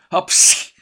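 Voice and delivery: loud explosive acted sneeze; sudden, forceful mock sneeze. A narrator's reading. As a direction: burst out with a stagey achoo. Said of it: "tüsszentett újra".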